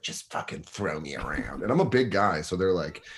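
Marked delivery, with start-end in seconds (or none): 0.0-1.6 low sultry plea. Retold or imitated. Women asking to be roughed up.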